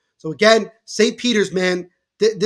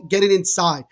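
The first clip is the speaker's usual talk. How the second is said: ordinary talk, nothing marked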